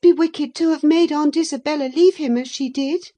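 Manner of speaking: put-on high, loud, indignant girl's voice; indignant young girl. A narrator's reading. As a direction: argue hotly as an outraged girl. Indignant girl in an acted quarrel.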